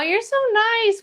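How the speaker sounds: ordinary delivery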